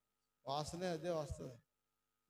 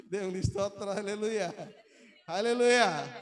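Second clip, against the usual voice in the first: preacher's shout; 2 semitones higher, 9 dB louder than usual. Real, not acted. Preacher calling praise out to the congregation. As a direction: lift the whole room.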